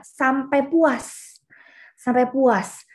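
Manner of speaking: sampai puas stretched very slowly; slow, drawn out. Shown as a demonstration. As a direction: let puas linger long. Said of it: "teaching emphasis". (ucapkan sampai puas sangat pelan)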